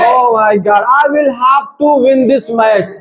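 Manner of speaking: very slow, every word stretched out; slow deliberate English. Shown as a demonstration. Teacher demos English to the class, very slow and stretched. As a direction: drag each word like a learner. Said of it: "ऐसा बोलो कि ऐसे लगे कि मतलब है सीख रहे हैं कुछ".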